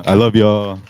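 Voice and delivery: calling out. Real, not acted. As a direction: call it out to the whole room. Signing off, louder repeat to crowd.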